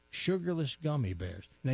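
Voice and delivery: usual speaking voice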